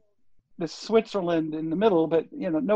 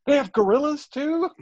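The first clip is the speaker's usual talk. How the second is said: surprised mom; 10 semitones higher than usual. Retold or imitated. Mom voiced in retold story.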